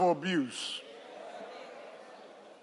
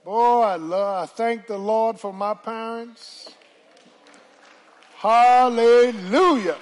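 loud, slow, soaring praise; full-force preaching; 4 semitones higher, 9 dB louder, slower than usual. Acted. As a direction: lift the church with a ringing hallelujah.

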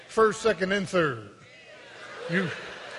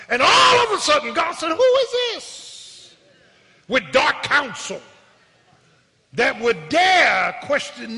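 preaching that bursts into high, loud questions; fervent preacher voicing God, surging to shouts; God's thundering challenge; 5 semitones higher, 5 dB louder than usual. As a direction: demand answers as an indignant God, from the pulpit. Preaches God's challenge at full force.

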